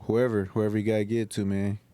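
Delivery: normal talking voice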